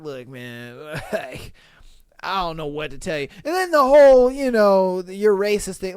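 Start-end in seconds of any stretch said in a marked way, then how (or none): 0.0-3.3 low, softer sheepish aside; awkward apology. Pretend. Operator letting a guest down, low and sheepish. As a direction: say it low and helpless, half chuckling.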